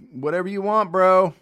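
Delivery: loud, high eager bro shout; bro-ish call. Retold or imitated. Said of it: "I think those are the exact words".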